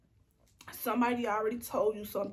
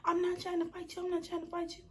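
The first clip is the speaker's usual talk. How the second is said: high mock girl voice; 8 semitones higher than usual. Imitating the girl denying.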